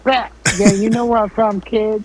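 high, loud street braggart; tough rapper voice. Retold or imitated. Mimicking tough gun-waving rapper.

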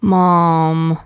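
Mom held low and level; "Mom" drawn out on a low even pitch. Shown as a demonstration. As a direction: say "Mom" low, without any lift. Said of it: "they all mean the same thing".

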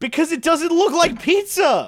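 high, slow, emphatic comeback; exasperated protest. Real, not acted. Answering why it's not pizza, exasperated. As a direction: pitch it way up and labor each word. Comic rant against Chicago pizza.

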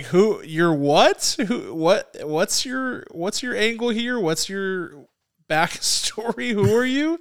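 high, drawn-out bewildered questions; mock confusion at the accents; baffled questioning. Critic imitating her own baffled reaction, high and puzzled. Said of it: "I was like".

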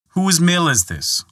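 stern demand. Acted. Prince demands after the whipping.

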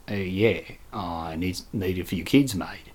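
very low, muted Australian; barely voiced, awkward Australian imitation; Australian accent. Deflated customer asks for keys, low quiet Australian accent. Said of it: "Remember the Australian guy".

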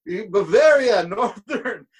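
put-on high, loud laughing German-accented promoter; German promoter correcting band, laughing German accent; broken German promoter. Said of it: "He goes".

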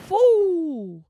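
high, loud whoop of joy; overwhelmed happy whoo; excited whoo. Retold or imitated. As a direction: let the joy burst out. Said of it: "it was like".

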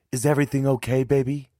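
high, loud, anxious question; alarmed motherly concern, pitched high; worried mother. A narrator's reading. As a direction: check urgently that your child is safe. Worried mother questions her frightened child.

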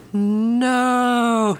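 drawn-out high wail of no; cowboy wailing on his knees; anguished cry. Acted. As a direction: cry out in despair. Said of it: "he cries".

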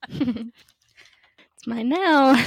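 slow, drawn-out and smug; playful gloating. Real, not acted. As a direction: gloat over the stolen snack. Playful gloating over stolen jerky.

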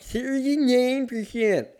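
high, slow slurred numbers. Real, not acted. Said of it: "I sound like I'm fuck-, I slurred".

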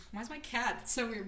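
ordinary talk

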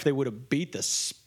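normal talking voice

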